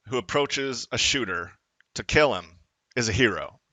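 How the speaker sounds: ordinary delivery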